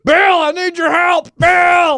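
high, slow, drawn-out shout; mock cry for help. Acting out a man calling Bill mid-fight, mock desperate yell. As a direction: holler for help across the lake.

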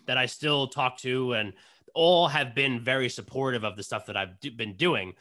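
ordinary talk, nothing marked

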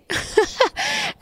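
high-pitched giggling burst; high, giddy giggle; giddy laugh. Real, not acted. Giddy laugh after engagement announcement.

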